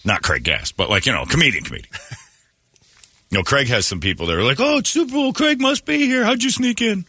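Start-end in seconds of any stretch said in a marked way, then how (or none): 4.6-7.0 very high-pitched mock fan voice; gushing fans. Fans spotting a comedian at the event, mock gushing. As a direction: squeal it lightly like an excited admirer. Mimics people greeting comedian Craig.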